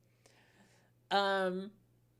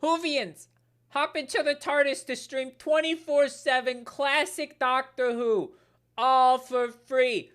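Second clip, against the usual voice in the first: announcer voice; 7 semitones higher, 8 dB louder, slower than usual. Acted. Reads a sponsor ad.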